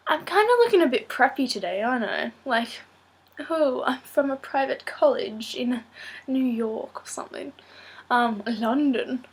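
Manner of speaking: playful posh British accent, lightly put on; a lightly put-on British accent, teasing about looking preppy. Vlogger jokes about looking preppy, in a playful British accent. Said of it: "when I was doing a British accent".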